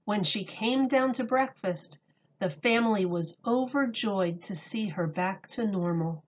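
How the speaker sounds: calm storybook reading voice; reading to children. A narrator's reading. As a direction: read it to a class of little kids. Reads picture book aloud.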